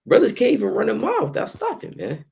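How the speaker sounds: high, mocking put-on voice. Mocking out-of-shape brothers.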